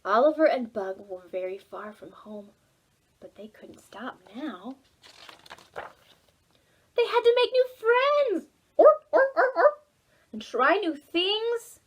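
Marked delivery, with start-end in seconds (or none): 1.3-4.8 soft, unvoiced whisper; hushed narration. A narrator's reading. Storyteller to children, whisper. 8.8-9.8 loud, high animal calls; high animal orp calls. Acted. Storyteller making animal calls, high and loud.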